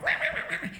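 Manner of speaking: very high mock crying wah wah; playful fake wailing; mock whining wah. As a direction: whine like a crybaby. Complaining email to medium.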